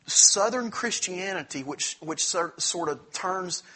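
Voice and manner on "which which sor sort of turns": ordinary talk, nothing marked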